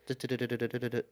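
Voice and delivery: rapid-fire du-du-du; imitated texting noise. Telling how the ex fired off a text, imitated typing noise. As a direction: rattle off nonsense syllables like quick typing.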